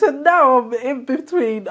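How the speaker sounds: normal talking voice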